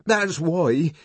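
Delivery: high, loud and urgent; agitated outburst. A narrator's reading. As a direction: blurt it out in agitation. Savage scrambles away, blurting explanation.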